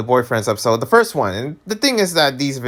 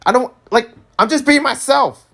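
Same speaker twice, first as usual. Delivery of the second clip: mad rant; 10 semitones higher than usual. Real, not acted. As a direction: snap back at the haters. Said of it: "I'm so mad".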